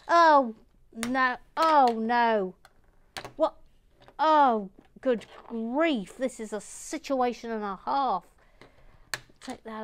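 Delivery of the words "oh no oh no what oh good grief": loud, drawn-out exclamations of dismay. Real, not acted. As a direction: cry out as the job goes wrong. Dismay at stuck brick.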